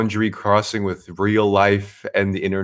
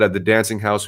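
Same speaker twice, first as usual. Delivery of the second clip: ordinary talk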